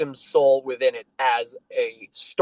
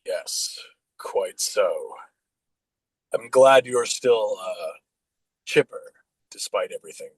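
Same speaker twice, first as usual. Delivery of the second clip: tired; 5 semitones lower than usual. Acted. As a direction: sigh the words out, worn down. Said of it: "tiredly says".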